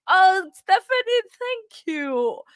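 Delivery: high, loud gush of thanks; about to cry happily. Real, not acted. Streamer gushing thanks to Stephanie, happily tearful. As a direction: cry out gratefully, softening on the thanks. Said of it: "I'm still gonna cry".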